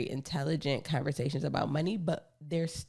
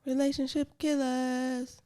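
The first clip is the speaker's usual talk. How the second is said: airy, high, slow, drawn out; dream voice; 10 semitones higher than usual. Shown as a demonstration. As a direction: float it out like in a dream. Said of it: "I tried to say that in my dream voice".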